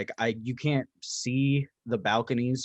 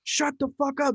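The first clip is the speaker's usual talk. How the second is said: higher mock yell out the door; retold yell; 13 semitones higher than usual. Shouting at women at neighbor's door, high mock yell. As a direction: yell it at the intruders. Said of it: "stuck my head out".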